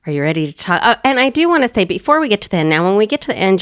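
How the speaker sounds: ordinary delivery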